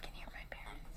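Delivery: hushed whisper so parents won't hear. Real, not acted. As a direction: whisper it quietly.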